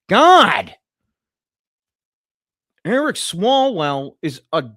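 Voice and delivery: sudden loud, high outburst; exasperated shout. Acted. Streamer reacting to the news, exasperated shout.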